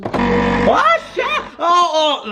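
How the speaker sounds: shocked outburst; high startled yelp. Real, not acted. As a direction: yelp it in alarm. Discovers no water.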